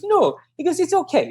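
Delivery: high, light put-on voice; imitated carefree runner. Runner reassuring his doubting coach, imitated high and carefree. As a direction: brush off the worry in a high voice.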